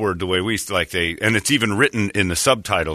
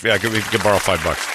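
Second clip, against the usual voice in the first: usual speaking voice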